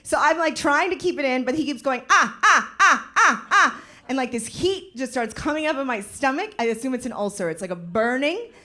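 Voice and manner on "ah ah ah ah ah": high loud mocking "ah ah" grunts. Jeering man on the subway, mocking grunts. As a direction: mimic a jeering man's grunts.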